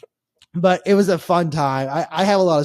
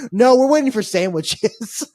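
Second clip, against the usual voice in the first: put-on drunk Southern reply; 7 semitones higher, 6 dB louder, slower than usual. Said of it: "They go".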